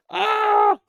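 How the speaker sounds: sudden high yelp of stinging pain; a real, loud, high cry as the eyes burn. Real, not acted. Streamer's eyes burning from grated onion, loud pained yelp.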